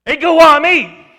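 loud high forceful proclamation; Jesus declaring to Caiaphas, loud and forceful. Acted. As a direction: ring it out with full force. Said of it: "I think he said it forcefully".

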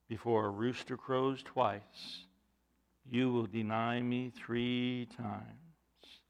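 low, soft, very slow; solemn remembered warning. Acted. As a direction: speak it slowly and softly, weighting each word. Recalling Jesus' remark to Peter.

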